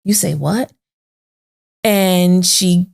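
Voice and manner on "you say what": stunned disbelief. Retold or imitated. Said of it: "was like".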